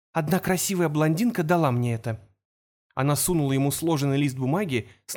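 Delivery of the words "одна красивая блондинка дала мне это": ordinary delivery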